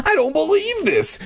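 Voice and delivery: very high acted disbelief; trembling exclamation. A narrator's reading. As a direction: exclaim way up high, mock-alarmed. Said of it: "exclaimed in a trembling voice".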